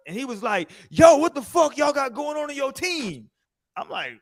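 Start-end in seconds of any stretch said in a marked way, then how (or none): 0.9-3.3 angry teammate. Retold or imitated. Angry teammate voiced in story.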